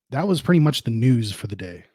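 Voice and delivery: ordinary delivery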